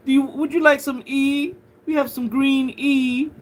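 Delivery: "E" with the T dropped, posh tone; T dropped, put-on posh English voice; posh British accent. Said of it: "super posh".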